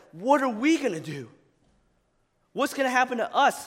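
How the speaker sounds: mock panic. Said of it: "everyone around him is like".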